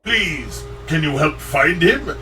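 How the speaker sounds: strained high nasal Wario-ish voice. Acted. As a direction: push up into a cartoonish Wario. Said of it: "decent Wario-ish".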